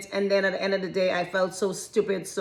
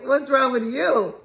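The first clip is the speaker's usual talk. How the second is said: mock-indignant retort; challenging comeback; 2 semitones higher than usual. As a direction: throw the question back at them in full voice. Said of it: "You're looking at them like".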